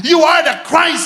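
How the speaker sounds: normal talking voice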